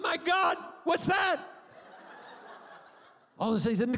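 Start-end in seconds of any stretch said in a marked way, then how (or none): 0.0-1.5 mock shock. New father shocked at baby crowning. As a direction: gasp it like a stunned new father.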